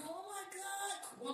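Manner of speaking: high, soft, drawn-out fan gasping; slow, high, hushed mock awe; awestruck fan imitation. Imitating arriving fans.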